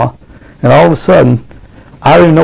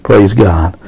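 normal talking voice

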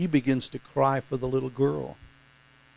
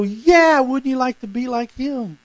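mock admiring exclamation; 11 semitones higher, 7 dB louder than usual. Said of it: "and you go".

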